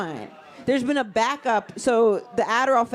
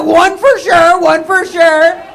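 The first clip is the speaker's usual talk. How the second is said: slow, drawn-out dopey repeat; mock dumb guy; 7 semitones higher, 13 dB louder, much slower than usual. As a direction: play the guy proudly sure of one. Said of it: "He's like".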